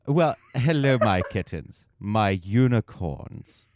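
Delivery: loud, slow showy hello; hammy host greeting. Acted. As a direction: welcome the audience like a game show host. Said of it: "hammy host greeting again".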